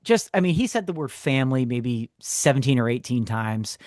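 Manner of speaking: normal talking voice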